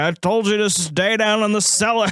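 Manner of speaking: high, loud put-on old man voice. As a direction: voice the old man scolding her from the cellar. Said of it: "he's just like".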